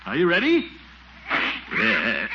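ordinary delivery

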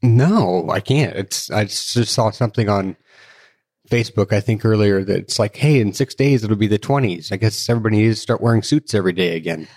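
ordinary talk

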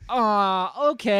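high, loud chirpy okay; hammed-up breezy agreement; mock cheerful acceptance. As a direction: chirp it like all is forgiven. Voicing woman accepting apology.

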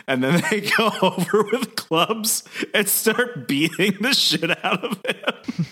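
slow, high, laugh-broken narration; real laughter breaking up the words; laughing while telling. Real, not acted. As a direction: struggle to get it out through laughter. Narrator cracks up at slapstick beating.

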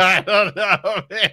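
high, slow laughing reply. Real, not acted. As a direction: giggle through the words. Laughing after the joke question.

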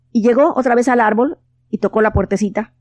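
plain reading voice

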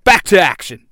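loud team leader's call; rallying yell. A narrator's reading. Jason sending the Rangers back out, loud rallying yell. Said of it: "Jason yells".

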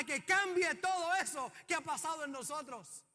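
loud, rising fervent preacher cry; preaching at full force. Acted. Pastor crying out to his church about brokenness, preaching hard. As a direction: drive it home to the congregation, full voice.

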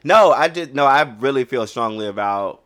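impassioned and insistent, voice jumping up; speaking passionately. Real, not acted. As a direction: push back with real conviction, loud at the start. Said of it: "why I'm speaking so passionately".

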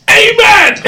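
high loud church shout; shouted amen. Pretend. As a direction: belt out the amen.

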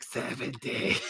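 eerie whispered threat; hushed, hammed-up spooky whisper; creepy horror-movie ghost. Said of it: "show up on the next episode and be like".

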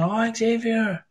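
high, slow "oh, Xavier" of sudden recognition; drawn-out realization. Retold or imitated. Telling the chat how he finally placed Xavier, slow and high. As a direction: stretch it out like it just clicked.

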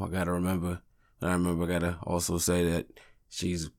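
normal talking voice

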